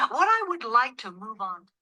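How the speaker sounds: usual speaking voice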